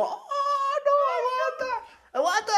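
high squealing mom voice, hammed up; a shocked mother's falsetto cry; mock shocked mom. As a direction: play the horrified mom yelping. Mom's shocked reaction in story.

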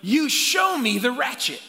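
fired-up preacher voice; preaching. Acted. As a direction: preach it loud to the congregation. Preaching at full force.

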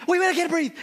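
put-on high breathless child pleading; mock gasping plea to wait; breathless panic. Son panics again.

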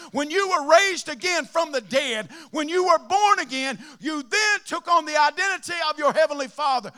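loud, high fervent preaching. Acted. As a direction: shout it to the whole house. Preaching at full force.

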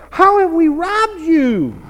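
loud, high, drawn-out mock indignation; hammed-up wounded protest; indignant protest. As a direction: act out an outraged question to God. Acts out the people challenging God.

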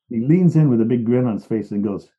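ordinary talk

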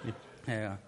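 normal talking voice

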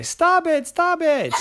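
high, loud stop-it squeak; high annoyed squeak; annoyed squirrel. Acted. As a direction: fend her off. Friend annoyed at chasing.

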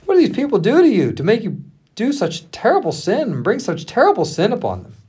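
a touch of outrage in a storyteller's reading; demanding. A narrator's reading. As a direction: demand an answer, pitch swinging high. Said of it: "he turned to Aaron and demanded".

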